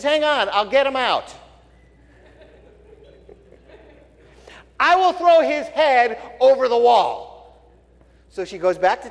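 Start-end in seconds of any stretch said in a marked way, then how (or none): 0.0-7.3 woman promising. Retold or imitated.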